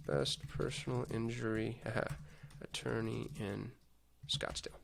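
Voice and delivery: very soft, halting murmur; under your breath, with pauses; talking while typing. Real, not acted. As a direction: mumble the search query to yourself. Typing a search query aloud.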